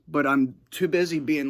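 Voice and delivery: normal talking voice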